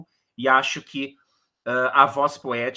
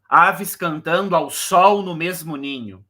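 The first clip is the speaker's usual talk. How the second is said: "sol" hit hard and loud as the sixth syllable; hammered sixth syllable; 3 semitones higher, 5 dB louder than usual. Shown as a demonstration. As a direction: hammer the "sol" beat, louder overall. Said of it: "a martelada ali".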